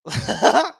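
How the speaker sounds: a short, high laugh; a quick high real chuckle. Real, not acted. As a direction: let a real laugh slip out. Reacting to clip.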